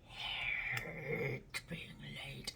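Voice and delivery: slow breathy confession; slow whispered admission. Real, not acted. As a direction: whisper it like a secret. Confessing quietly after an aside.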